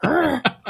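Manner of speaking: sudden scary roar; monster growl. Retold or imitated. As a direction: jump out with a growl. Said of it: "and I'm like".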